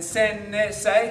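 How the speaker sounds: sen-ne-say, an extra ne in the middle; added syllable. Shown as a demonstration. Teacher showing a non-word, added syllable ne. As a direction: slip ne between sen and say. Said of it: "Don't add a syllable".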